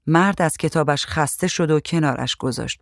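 plain reading voice

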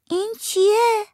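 put-on high, childlike voice for the young niece; little girl voice. A narrator's reading. Little girl asks her aunt what she found, high child voice. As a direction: speak up high like a small girl asking.

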